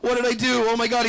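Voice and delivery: hammed-up horror at what just happened; panicked Superman. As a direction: cry out in shrill terror, then keep on panicking. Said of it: "He's like, Oh".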